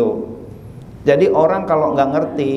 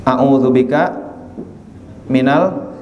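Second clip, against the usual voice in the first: 1 prayer recitation; slower than usual. Acted.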